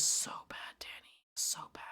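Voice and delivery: hushed whisper, barely voiced. Real, not acted. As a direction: whisper it like a secret aside. Whispered aside after complaint.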